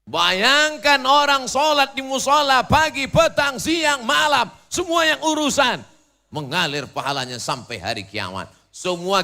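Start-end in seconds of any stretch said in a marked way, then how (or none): 0.0-5.8 high, loud exhortation to a crowd; raised, ringing pulpit voice; full-force preaching. Real, not acted. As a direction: urge the congregation at a shout.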